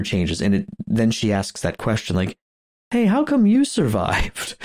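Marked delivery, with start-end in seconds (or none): 2.9-4.6 high, drawn-out mock questioning; pointed questioning. Podcaster acting out her question to the suspect, pointedly. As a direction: act out a pointed, suspicious question.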